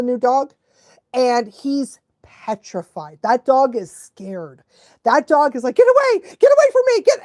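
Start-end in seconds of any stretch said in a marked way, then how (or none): 5.7-7.2 high, fast panicked dog voice; mock freaking out. Scared dog fending off the others, mock panic. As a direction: act a frantic dog, loud and rushed. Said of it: "he's just freaking out".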